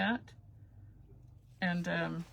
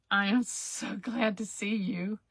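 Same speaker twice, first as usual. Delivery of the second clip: ordinary talk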